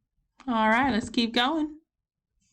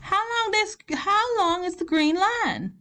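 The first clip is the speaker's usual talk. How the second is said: higher-pitched Southern teacher; Southern accent; 7 semitones higher than usual. Real, not acted. Teacher reading the question to students, Southern accent slipping out. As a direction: let the Southern accent slip out. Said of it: "my southern accent keeps coming out".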